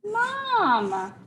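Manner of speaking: high, drawn-out call. Retold or imitated. Child in tree calls for mom, mock calling out. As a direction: stretch it out high, calling for someone. Re-enacts the call while writing.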